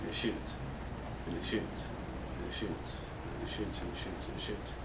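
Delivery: soft, low, trailing repeats; fading repetition. Pretend. Host stuck on the gun's one virtue, muttered fading repeats.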